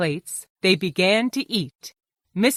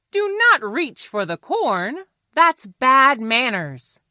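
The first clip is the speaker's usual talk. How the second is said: firm correction; 5 semitones higher than usual. Acted. Fox tells Johnny off for reaching, firm correction. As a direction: correct a child's table manners.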